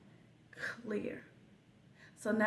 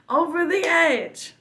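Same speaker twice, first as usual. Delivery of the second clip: higher, louder and slower, driving it home; preaching emphasis; 7 semitones higher, 6 dB louder than usual. Real, not acted. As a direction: push the phrase out like a preacher's peak. Preaching climax about God's test.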